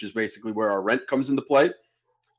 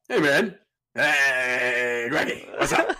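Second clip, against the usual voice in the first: put-on drunk buddy voice; much slower than usual. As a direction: play the drunk friend chirping hello. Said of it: "Call him back".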